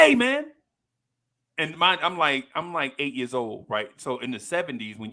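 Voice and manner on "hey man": put-on much higher, cocky kid greeting; mock cool kid. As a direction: toss it off like a cheeky boy. Kid greets teacher casually.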